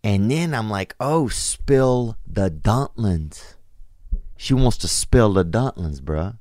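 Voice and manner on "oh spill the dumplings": drawn out, slow realization; dawning realization. Retold or imitated. Comic telling friends he finally understood her, slow realization. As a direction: let it dawn slowly, word by word.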